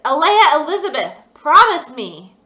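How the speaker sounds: father loudly demanding a promise; full-name command. Acted. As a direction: say her full name like a last warning. Father insists using full name.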